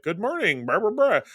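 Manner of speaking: lightly voiced Moira, rising on the blahs; a breezy greeting, hinted; retold doctor's cheery morning hello. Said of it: "and she's just like".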